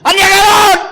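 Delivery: high, loud shout of accusation; scolding shout. Retold or imitated. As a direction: yell it at the sinner. Climax of mocking scolding preacher.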